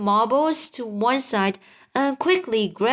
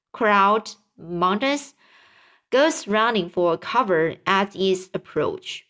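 usual speaking voice

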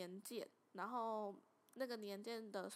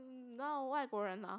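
ordinary talk

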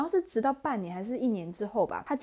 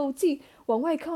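ordinary talk, nothing marked

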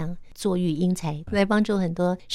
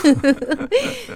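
a high, ringing laugh; long laugh; 9 semitones higher, 4 dB louder than usual. Real, not acted. Host laughs when guest asks how she knew, ringing laugh. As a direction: crack up at being caught out.

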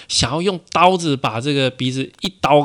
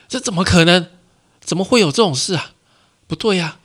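shocked disbelief; 7 semitones higher, 4 dB louder than usual. Acted. Robber shocked by mirror.

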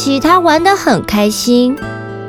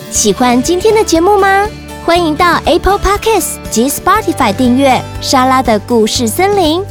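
podcast promo read; 4 semitones higher than usual. Acted.

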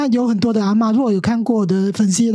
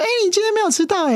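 high, quick mock surprise; pleasantly surprised; 9 semitones higher, much faster than usual. Teacher to the usually late girl, playfully surprised. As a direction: tease her, amazed she's on time. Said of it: "然后一来我就说".

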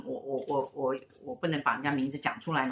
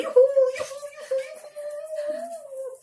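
much higher falsetto whoops of yoo-hoo; imitating ghosts cheering; much slower than usual. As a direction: mimic a crowd whooping at a concert.

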